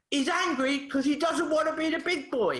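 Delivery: high-pitched angry yell, imitating a child; retold boy's yell. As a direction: burst out like a boy who can't hold it in. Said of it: "before Peter just yelled at me".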